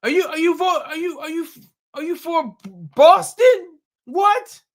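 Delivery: hammed-up indignant filmmaker rising to a loud squawk on Boston; Spike Lee impression. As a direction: play Spike Lee as indignant and squeaky-high. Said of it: "He'll be like".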